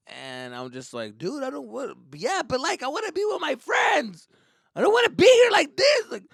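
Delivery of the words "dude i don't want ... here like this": high shouted whine; high, loud teenage protest; whining teen. Retold or imitated. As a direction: complain rising to a yell.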